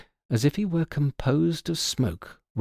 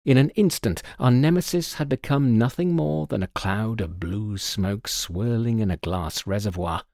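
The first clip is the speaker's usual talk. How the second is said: ordinary delivery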